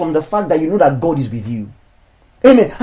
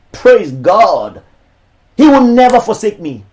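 raised, declamatory sermon voice; preaching; 4 semitones higher, 4 dB louder than usual. Acted. Pastor preaching against charging for ministry, declamatory. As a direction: preach it to the church, each line landing.

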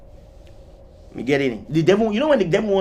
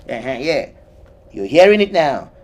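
usual speaking voice

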